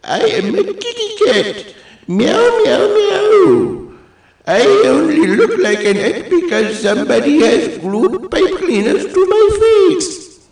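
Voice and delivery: very high squeaky cat, indignant and giggly. Acted. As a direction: squeak out a protest like an offended kitty. Voices the cat figure.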